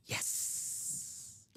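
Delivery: whispered, drawn-out hissing s; Sir Hiss snake voice. Shown as a demonstration. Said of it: "Like I'm Sir Hiss over here".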